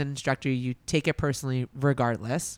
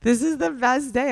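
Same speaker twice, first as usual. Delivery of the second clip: much higher and louder, gushing; excited; 10 semitones higher, 5 dB louder than usual. Retold or imitated. Husband gushing to his wife about her sauce, excited. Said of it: "visibly excited".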